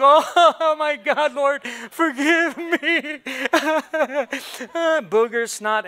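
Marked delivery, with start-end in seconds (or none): 0.0-2.7 laughing mock sob. 2.7-5.0 howling laugh. Retold or imitated. Emoji crying laughter.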